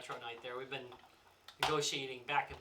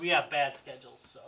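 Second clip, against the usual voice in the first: normal talking voice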